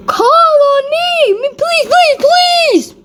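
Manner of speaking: put-on high squeaky pleading child voice; eager begging. A narrator's reading. Lily begs teacher to call on her, high pleading. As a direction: beg to be picked, high and eager.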